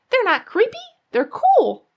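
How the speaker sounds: little rabbit protesting; insistent child. A narrator's reading. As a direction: argue back at mom, indignant.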